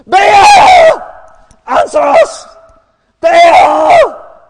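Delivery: high, very loud scream; straining shout. Acted. Prophets starting to lose their voices, screaming. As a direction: scream till the voice nearly goes. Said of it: "starting to lose their voices".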